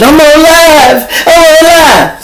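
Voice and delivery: high, drawn-out wailing shout; hammed-up high-pitched holler; mock panicked yell. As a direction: cry it out like a hysterical livestreamer, mocking. Mocking people livestreaming during arrest.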